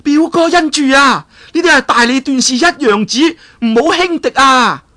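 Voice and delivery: loud warning. Acted. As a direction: call out to warn her cousin. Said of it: "黃雨即刻大聲叫啦". (王語嫣大聲警告表哥，聲調好高)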